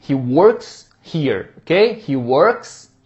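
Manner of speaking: very slow, deliberate he works here; teacher modelling he works here; slow model. Shown as a demonstration. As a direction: say each word slowly. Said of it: "ele trabalha aqui".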